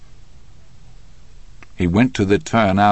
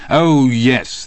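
higher, mocking prosecutor; sarcastic; 4 semitones higher, 3 dB louder than usual. A narrator's reading. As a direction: feign agreement with open sarcasm. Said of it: "the D A smiled sarcastically".

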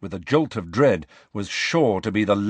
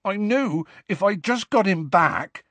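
close to tears; 10 semitones higher than usual. A narrator's reading. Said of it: "sounding close to tears himself".

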